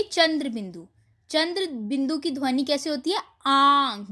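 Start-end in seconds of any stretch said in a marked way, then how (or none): 3.5-4.1 आंख said loud with the mouth open wide; open-mouth nasal. Shown as a demonstration. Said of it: "मुंह खोल कर बोलेंगे आप". (आंख मुंह खोलकर ज़ोर से बोलिए)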